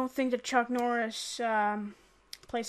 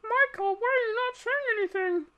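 high-pitched, put-on questioning voice; squeaky mock complaint; imitated nagging viewer; 9 semitones higher than usual. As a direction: ask it high and quick, mocking a viewer. Voices a viewer questioning his silence.

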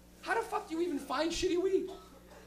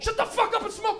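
high loud angry snap; snapped order; 9 semitones higher, 7 dB louder than usual. Retold or imitated. Friend snapping at nonsmoker, loud angry order. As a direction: bite his head off. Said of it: "I don't smoke".

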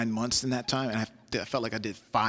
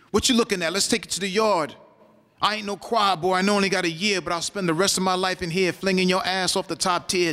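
higher, pushy prison-yard challenge; tough inmate challenge; 7 semitones higher, 5 dB louder than usual. Acted. Prisoner daring another to fight in the yard, challenging. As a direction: square up to a staring inmate.

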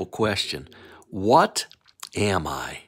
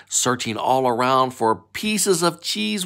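ordinary delivery, nothing marked

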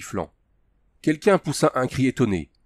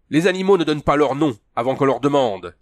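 curt, louder and higher rebuke; 5 semitones higher, 5 dB louder than usual. A narrator's reading. Tugar rebukes his captive for naming himself, curt. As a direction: cut the captive down to size. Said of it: "fit sèchement le Tugar".

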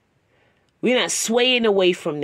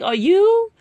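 surprised question; 13 semitones higher, 5 dB louder than usual. Retold or imitated.

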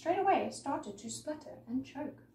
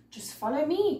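louder, higher toad character; croaking old toad; 4 semitones higher, 6 dB louder than usual. A narrator's reading. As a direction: call out as a lumpy old toad. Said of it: "croaked a lumpy old toad".

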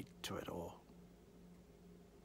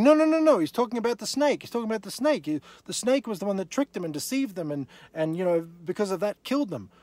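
much higher and louder protesting voice; mock objector; 7 semitones higher, 5 dB louder than usual. Preacher mimics a skeptic in the congregation, high and insistent. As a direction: ham up the doubter's protest. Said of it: "You think".

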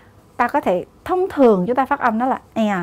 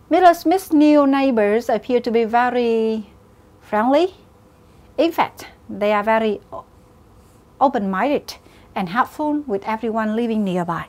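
reading a test question aloud. Acted. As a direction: read the question out clearly for the class. Teacher reads exam question aloud.

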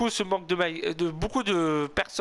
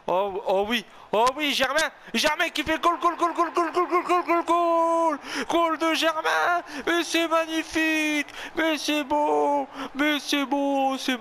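excited goal call; 8 semitones higher, slower than usual. Acted. Streamer calling Germain's goal in commentator style. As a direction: roar the goal then savour it slowly.

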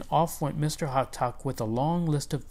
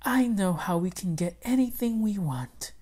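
higher, sly wife voice; a knowing wife, pitched up; wife with a clever plan; wife's voice; 6 semitones higher than usual. Acted. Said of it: "she said".

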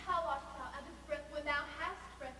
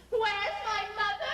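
exasperated; 5 semitones higher, 10 dB louder than usual. Acted. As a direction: throw her words back at her. Juliet mocks nurse's words.

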